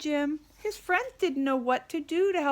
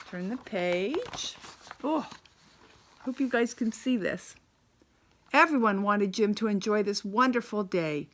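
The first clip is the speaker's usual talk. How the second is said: ordinary delivery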